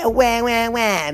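high, whiny wah-wah; mock wailing. Mimicking Trina's whining, mock wails. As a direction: imitate a bawling baby. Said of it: "Trina is just like".

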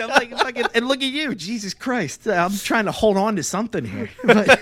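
high, loud, breaking into laughter; can barely get the words out for laughing; laughing hard. Real, not acted. Cracking up at friend's story.

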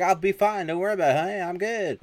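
high-pitched, breezy brush-off; acted-out dismissive husband; hurried reassurance. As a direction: call back to the wife as you escape. Husband brushes off worried wife.

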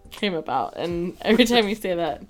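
usual speaking voice

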